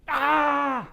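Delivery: high, loud "ah" scream; startling scream. Retold or imitated. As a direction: scream out suddenly like a man startled in a dumpster. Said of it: "this guy screams out from inside".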